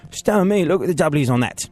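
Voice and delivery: high-pitched English accent. Shown as a demonstration. Host shows the phrase works better when English, high English accent. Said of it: "But if you're like".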